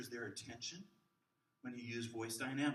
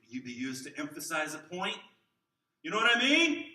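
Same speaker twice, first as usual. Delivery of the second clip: louder, rising to a high shout; loud demo; 4 semitones higher, 13 dB louder than usual. Shown as a demonstration. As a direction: build up to a loud call. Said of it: "speaking loudly".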